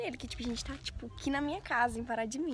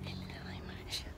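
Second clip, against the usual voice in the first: whispering; 7 dB quieter than usual. Real, not acted. Secretly talking to camera.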